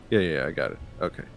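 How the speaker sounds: ordinary talk, nothing marked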